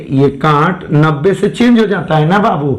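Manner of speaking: usual speaking voice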